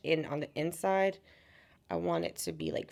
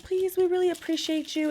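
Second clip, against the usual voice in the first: much higher, mock-sweet begging; pleading showgirl; 11 semitones higher than usual. Showgirls pleading with tourists for a tip, high wheedling voice.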